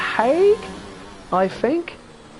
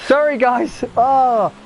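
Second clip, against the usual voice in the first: flustered apology; 5 semitones higher, 3 dB louder, slower than usual. Real, not acted. Apologising for his mistake.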